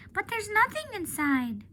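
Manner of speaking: high, innocent little-elephant voice, puzzled; young elephant Ruby. Acted. Ruby asking Ivan about the carrots, high and puzzled. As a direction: play Ruby as a small, curious child. Ruby's voice in the read-aloud.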